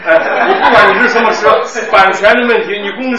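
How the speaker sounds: laughing teasing. Real, not acted.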